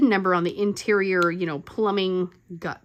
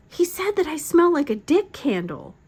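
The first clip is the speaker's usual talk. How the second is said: high-pitched mock teen indignation; indignant teenager; 7 semitones higher, 4 dB louder than usual. Mother mimicking her teenager reporting what dad said, indignant. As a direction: imitate an offended kid tattling.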